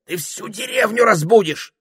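high, loud scolding; останавливая. A narrator's reading. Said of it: "остановил её попугай". (одёргивая, высоко и громко)

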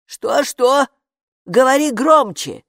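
loud, high gatekeeper shout. A narrator's reading. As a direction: yell from far away. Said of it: "прокричала привратница". (громко кричит)

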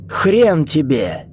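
spiteful outburst, high and drawn out; angry. A narrator's reading. Old man defying a corpse, angry. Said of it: "зло сказал старик трупу".

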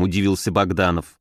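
usual speaking voice

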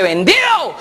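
high, sudden exclamation; instant eager cry. Retold or imitated. Seller jumping at the first offer, exclaiming. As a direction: blurt it out delighted.

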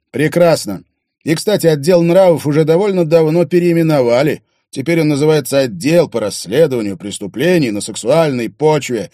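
loud, high smug officer; smug duty officer. Acted. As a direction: needle him with a smug tone.

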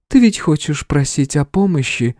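high, sweet feminine voice; strained smile, tender. A narrator's reading. Goddess prompts hero to ask, tender. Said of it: "богиня натянуто улыбается".

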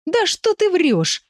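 high, slow, drawn-out indignant protest. A narrator's reading. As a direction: squeal in outraged disbelief. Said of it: "возмутился Виль". (возмущённо)

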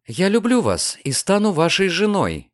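ordinary delivery

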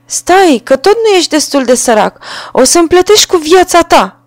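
very high, loud bird-voice threat; a tiny bird vowing his death; threatening sparrow. A narrator's reading. Sparrow threatening the carter's life, high shrieking threat. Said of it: "a zis Vrabia".

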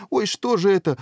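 high, startled cry of dismay; aghast, voice jumping up; dismayed queen. Acted. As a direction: exclaim in royal alarm. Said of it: "всплеснула руками королева".

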